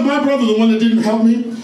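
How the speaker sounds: usual speaking voice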